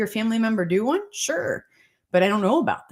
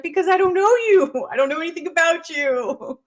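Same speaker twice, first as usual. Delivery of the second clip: high, loud comic protest; mock exasperation; 8 semitones higher, 5 dB louder than usual. Doctor answering pissed-off questioners, high mock-exasperated cry. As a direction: exclaim it in playful exasperation, breaking into a laugh. Acted exasperated reply to complainers.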